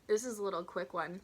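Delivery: plain reading voice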